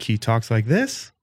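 slow, ending THIS on a questioning rise; mock upspeak. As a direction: imitate upspeak, lifting "this" like a question. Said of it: "has a terrible upspeak".

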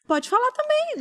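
very high, drawn-out mocking echo of the therapist; mock therapist's stare. Imitating the silent therapist to her listeners, a very high mocking echo. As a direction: toss her words back as a puzzled look would. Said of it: "ela ficava me olhando do tipo".

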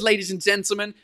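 usual speaking voice

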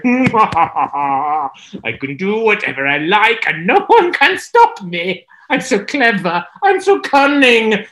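high, smug villainous boast; boastful villain. Acted. Jezebel boasting to herself, smug. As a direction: preen over your own cleverness.

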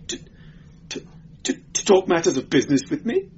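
high, stuttering, then rushed; taut nerves. A narrator's reading. Clerk making excuses to his interrogator, nervous stutter. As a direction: stick on the first word, then gabble. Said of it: "clerk's voice was shrill with taut nerves".

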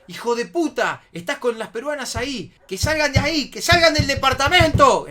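high, very loud yelling, building; escalating angry shout; furious imitated shouting. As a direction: yell it like an enraged friend in the background. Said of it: "Empezó a gritar".

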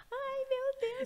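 relatives' high, delighted squeal at the news; mock excited gasp. Family reacts to pregnancy news.

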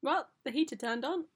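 normal talking voice

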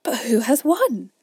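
loud, eager question; eager crowd. A narrator's reading. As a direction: ask all at once, panting. Animals crowd asking.

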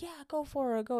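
put-on high, light voice of a kind older golfer; friendly older golfer. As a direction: wave them through cheerfully.

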